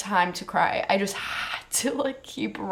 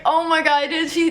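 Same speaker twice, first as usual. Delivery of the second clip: stunned disbelief; 7 semitones higher, 4 dB louder than usual. Retold or imitated. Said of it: "I was like".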